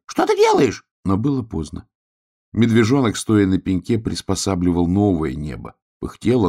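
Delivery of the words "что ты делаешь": shrieked, very high and loud; alarmed shout. A narrator's reading. Hedgehog as the bear pulls down the sky, alarmed shriek. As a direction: cry out in squeaky alarm. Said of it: "закричал ежик".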